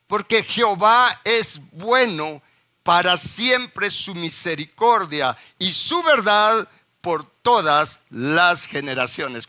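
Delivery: preacher proclaiming the verse slowly and loudly; solemn scripture reading. Acted. Reading the psalm aloud.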